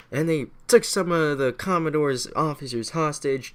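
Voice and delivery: normal talking voice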